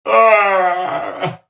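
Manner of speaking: loud mock wail; mock sob cry. Recalling the moment she cried, loud mock wail. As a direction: let out a sudden mock wail. Sound of crying start.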